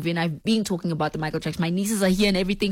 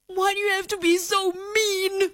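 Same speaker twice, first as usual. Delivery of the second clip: put-on high, whining child's voice; whiny child; 11 semitones higher than usual. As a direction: whine it like a hurt little boy. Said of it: "He says".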